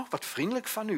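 high, touched and surprised; surprised stewardess. Retold or imitated. The stewardess thanking a calm passenger, high and surprised. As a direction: voice her delighted surprise up high.

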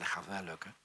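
low, soft and sure of himself; calm quiet insistence. Retold or imitated. Telling the ground stewardess it will work, quiet and calm.

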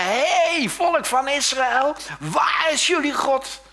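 long, drawn-out high bellow; slow, booming taunt; taunting giant's shout. Acted. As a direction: bellow a challenge across a battlefield. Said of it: "getergerd wordt door zo'n Goliath".

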